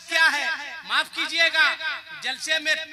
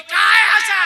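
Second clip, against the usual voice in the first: louder, higher retold scold; mock scolding mother; 5 semitones higher, 12 dB louder than usual. Mother scolding her laughing son at the wedding, mock-angry. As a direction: snap at the giggling groom as his mother. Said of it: "मुझको बोली".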